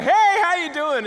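high, loud, drawn-out hello; overloud mock greeting. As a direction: hail a stranger far too heartily.

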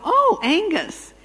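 high, slow, bright "Oh, Angus."; playful mock delight; delighted recognition. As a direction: greet the caller as if he were the dog. Recognizing the caller.

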